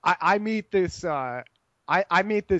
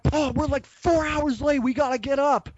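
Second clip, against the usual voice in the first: urgent wake-up call; 7 semitones higher than usual. Retold or imitated. Waking sleeping friend, late.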